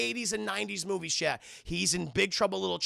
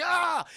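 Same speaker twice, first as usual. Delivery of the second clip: high, loud mock cry; imitated scream; 11 semitones higher, 5 dB louder than usual. Retelling Mel under torture, mock scream. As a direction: yell out as if tortured.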